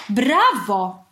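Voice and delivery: loud, delighted cheer; overjoyed exclamation. A narrator's reading. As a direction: burst out applauding. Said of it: "wykrzyknął uradowany czarodziej". (radosny okrzyk)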